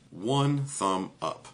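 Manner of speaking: slow, flat deadpan verdict. Real, not acted. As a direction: drag it out, unimpressed. Slow deadpan rating repeat.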